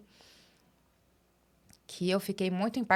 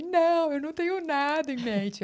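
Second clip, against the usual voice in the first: high-pitched, louder burst of retold distress; mock tearful; 9 semitones higher, 4 dB louder than usual. Employee answering boss about her plans, mock tearful. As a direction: act out pleading through tears, voice pitched way up. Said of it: "Eu começava a chorar".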